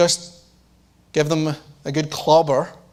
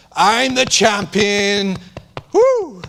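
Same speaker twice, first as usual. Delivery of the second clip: slow, swaggering giant's boast with a high whoop; mock Goliath taunt; 3 semitones higher, much slower than usual. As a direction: crow like a bragging champion. Preacher acting out Goliath's boast.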